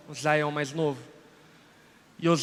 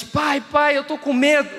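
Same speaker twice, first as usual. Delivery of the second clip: put-on scared child; 7 semitones higher than usual. Child voiced in retold story.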